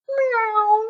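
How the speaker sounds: high mewing meow; cat meow. Retold or imitated. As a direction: mew like a cat.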